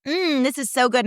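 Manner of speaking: high-pitched savoring gush; mock influencer delight. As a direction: imitate an influencer pretending to love it. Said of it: "and be like".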